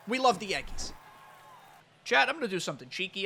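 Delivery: ordinary talk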